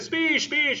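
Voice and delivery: high-pitched mock chant from the guests; party guests egging him on with a sing-song call; crowd calling for a speech. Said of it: "somebody would".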